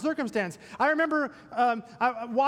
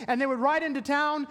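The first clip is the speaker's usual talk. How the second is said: ordinary talk, nothing marked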